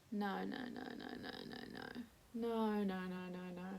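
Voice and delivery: soft, dazed string of no's, fading almost to a whisper; stunned quiet denial. Retold or imitated. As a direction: murmur it as if you can't believe your eyes. Stunned, staring at pregnancy test.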